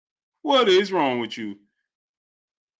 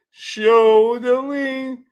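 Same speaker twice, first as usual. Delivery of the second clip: drawn-out mockery; 4 semitones higher, much slower than usual. Real, not acted. Mocking fighter's technique.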